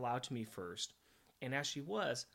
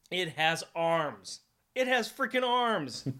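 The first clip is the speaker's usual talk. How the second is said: loud and slow, building to a high yell; mock-amazed shouting; 5 semitones higher, 10 dB louder, slower than usual. Host marvelling that the ship has arms, mock-amazed shouting. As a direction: holler it in escalating mock amazement.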